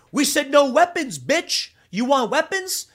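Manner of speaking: loud, high-pitched street-fight challenge; angry confrontation. Retold or imitated. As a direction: imitate a fighter calling out a rule-breaker. Acted challenge in retold street fight.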